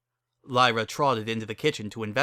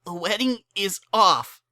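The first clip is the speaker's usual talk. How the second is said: sobbing; 9 semitones higher, 3 dB louder than usual. Acted. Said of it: "sobbed Lyra".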